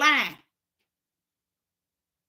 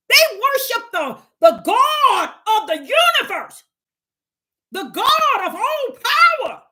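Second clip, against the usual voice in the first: higher, excited heathen voice, shouting out God; wide-eyed outsider marveling at a mighty God; awed heathen; 9 semitones higher, 6 dB louder than usual. Acted. Preacher acts out awed heathens.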